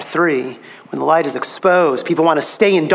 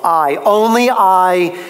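"I, only I" slow and louder, both "I" stressed; emphatic I; 3 semitones higher, 4 dB louder, slower than usual. Acted. Preacher voicing Jesus' claim to hearers, slow emphatic stress. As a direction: press down on each "I", slowly. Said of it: "it's emphatic in the original".

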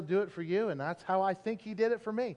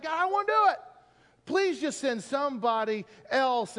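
whiny plea; 6 semitones higher than usual. Retold or imitated. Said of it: "It's like".

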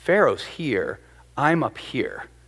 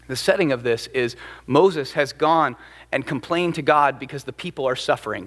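usual speaking voice

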